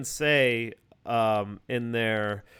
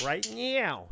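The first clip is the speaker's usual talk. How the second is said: put-on a light New York accent, pitched up high on "Right now"; jabbing New York accent, thrown back as a gotcha; mock New York accent; 9 semitones higher than usual. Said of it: "He has, like, that kinda, like, uh, New Yorkie accent".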